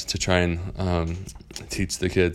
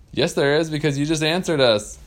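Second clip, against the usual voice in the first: higher, louder gotcha voice; triumphant kids; 8 semitones higher, 4 dB louder than usual. Acted. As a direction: catch him out gleefully. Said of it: "they said".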